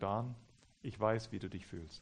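soft, low, understanding. Retold or imitated. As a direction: quietly soothe an upset man. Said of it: "meine Stimme gesenkt". (ruhig, mit gesenkter Stimme)

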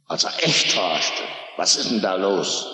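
ordinary talk, nothing marked

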